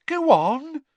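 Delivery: put-on high, bossy ghost girl; bossy gleeful ghost. A narrator's reading. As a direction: boss him around with relish. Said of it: "enjoying bossing him around".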